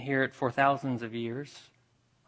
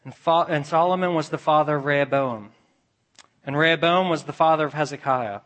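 louder, driving preacher's cadence; building genealogy recital; 2 semitones higher, 5 dB louder, faster than usual. Real, not acted. Preacher recites the lineage toward Christ, building recital. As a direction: roll each name into the next with rising intensity.

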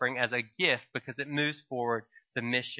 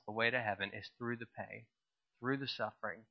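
much softer, solemn and reverent; a preacher quietly landing the message; hushed; 3 semitones lower, 12 dB quieter than usual. Real, not acted. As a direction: drop to a near hush on heaven and pain. Solemn closing point.

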